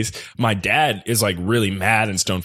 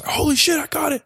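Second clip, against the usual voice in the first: mock scream of triumph, pitched way up; retold excited scream. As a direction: imitate a kid screaming in amazement, volume held back. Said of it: "He just, like, screams".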